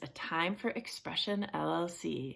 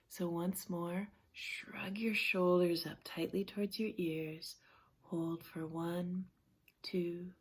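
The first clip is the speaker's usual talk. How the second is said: guided relaxation; 7 dB quieter than usual. Real, not acted. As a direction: guide them gently through each breath with long pauses. Leads a relaxation session.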